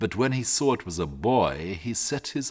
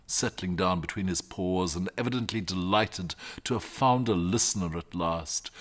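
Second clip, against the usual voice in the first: ordinary delivery, nothing marked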